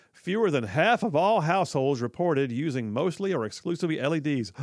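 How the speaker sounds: ordinary talk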